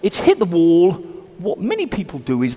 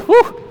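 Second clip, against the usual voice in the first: short, high, loud "woo"; exhaled whoop; 8 dB louder than usual. Real, not acted. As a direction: let out a quick high whoop after effort. Out loud after practice shots.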